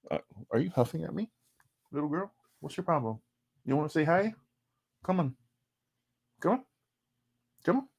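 high, soft cooing voice. Real, not acted. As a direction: coax a little pet sweetly. Coaxing his pet Sky.

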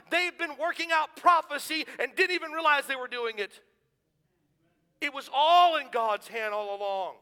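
high, loud, fervent sermon climax; preaching at full force. Acted. Preacher to congregation on God's plan, at full force. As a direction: drive it home to the congregation.

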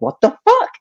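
high squealed disbelief; shocked squeal. Retold or imitated. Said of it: "I'm like".

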